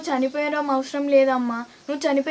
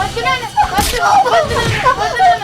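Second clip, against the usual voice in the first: rising, louder and higher begging; pleading; 8 semitones higher, 8 dB louder, faster than usual. Acted. Daughter pleads with drunken father, rising panic. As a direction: beg him to stop, getting frantic.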